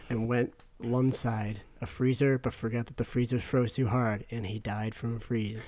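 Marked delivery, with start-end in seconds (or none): none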